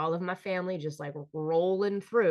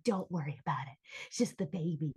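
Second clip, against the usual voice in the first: softer, indulgent reassurance; soothing family; 5 dB quieter than usual. Retold or imitated. Relatives excusing a baby's mess, mock soothing. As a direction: coo it away for the baby. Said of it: "everyone would be like".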